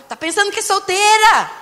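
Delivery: high, loud indignant imitation of a husband; mock scolding shout. Wife imitates husband objecting to her photo, mock scolding shout. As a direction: yell it like an outraged husband.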